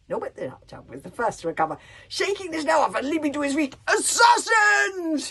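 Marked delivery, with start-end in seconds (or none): 3.9-5.3 huge scream of alarm. A narrator's reading. Warlord screams at the intruder in his soup, full shout. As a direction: scream the warning at the table. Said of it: "he screamed".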